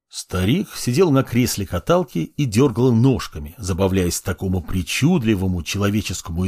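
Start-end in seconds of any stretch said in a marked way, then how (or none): none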